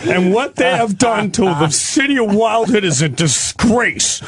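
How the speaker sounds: high-pitched, strained rant; cop having meltdown. Acted. Cop ranting about the animals in Wildwood, frantic meltdown. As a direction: lose it completely, voice climbing and words dragged out. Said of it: "He starts having a meltdown".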